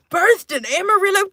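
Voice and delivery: pitched high, playful proclamation; hammed-up repeat. Streamer declaring Texas birthplace, high and hammed-up. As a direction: announce it high, playing it up.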